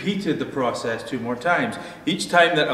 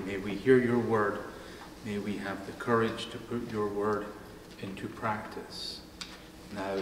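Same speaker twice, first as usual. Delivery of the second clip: softer, hushed prayer voice; 4 semitones lower, 11 dB quieter than usual. Acted. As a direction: pray gently, fading softer toward the amen. Leads the congregation in prayer.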